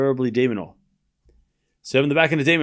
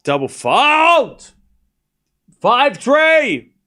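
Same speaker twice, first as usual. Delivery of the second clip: loud, drawn-out call of the score; cheering a rival's double fault; excited shout; 8 semitones higher, 5 dB louder, much slower than usual. Real, not acted. Streamer celebrating a double fault, loud and drawn out.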